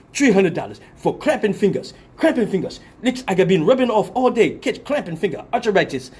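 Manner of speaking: put-on emphatic complaint in African accent; hammed-up African-accented grumbling. Braider justifying price by cramping fingers, African accent. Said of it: "this is my African accent".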